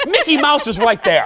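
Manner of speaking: high-pitched comic protest; hammed-up shocked reaction; mock scandalized. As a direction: protest as if appalled on a child's behalf. Acts out shocked reaction to swearing mother.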